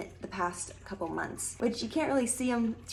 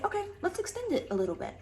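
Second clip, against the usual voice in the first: self-coaxing voice; 10 semitones higher, faster than usual. Retold or imitated. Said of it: "I'll say".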